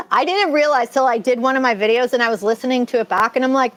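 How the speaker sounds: ordinary talk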